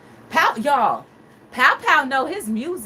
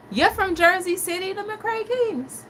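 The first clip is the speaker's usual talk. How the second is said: surprised; 8 semitones higher than usual. Real, not acted. Learning viewers are from Jersey City, high disbelieving question. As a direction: stretch the last words, amazed.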